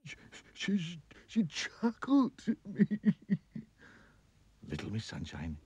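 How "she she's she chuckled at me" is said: high, wailing king sobbing oh dear; crying king. Acted. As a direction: wail like a weepy king. King complains.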